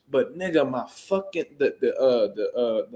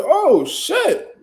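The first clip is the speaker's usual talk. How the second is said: mock surprise; 11 semitones higher, 4 dB louder than usual. Surprised reaction in the story.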